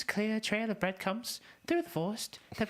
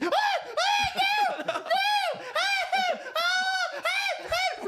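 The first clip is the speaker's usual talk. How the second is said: terrified screams; 5 dB louder, much slower than usual. Acted. Lost boy caught, screaming.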